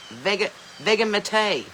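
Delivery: slow "Vegemite" as vega-ma-TAY; joke mispronunciation. Shown as a demonstration. Playfully guessing for viewers, "Vegemite" drawn out as vega-ma-tay. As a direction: sound out "Vegemite" with a hard G and a final "tay". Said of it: "How do you pronounce it?".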